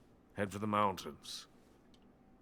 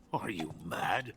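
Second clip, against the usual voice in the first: whispered, slow and urgent; hushed whisper; 3 dB quieter than usual. Acted. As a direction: warn him under your breath, slowly. Urgent hushed warning to spy.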